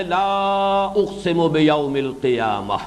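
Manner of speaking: slow recitation, long-held لا; measured scriptural chant; Quran recitation. Real, not acted. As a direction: hold لا long, then recite slowly. Recites the verse just announced.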